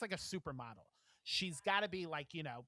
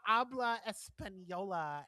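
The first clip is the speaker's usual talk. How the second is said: slow, mock American-accented Spanish; 2 semitones higher, slower than usual. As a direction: drag out bad gringo Spanish.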